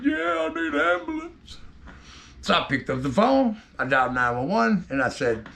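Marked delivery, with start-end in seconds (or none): 0.0-1.6 put-on higher, weaker old man's reply; lightly voiced injured uncle, softer; scene: bloodied uncle admits he needs help, higher and softer; hurt uncle. Beaten uncle answering weakly.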